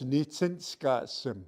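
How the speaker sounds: slow, clear "Nitsinskasim"; careful pronunciation. Shown as a demonstration. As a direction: spell out "Nitsinskasim" with the sound. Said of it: "Say that".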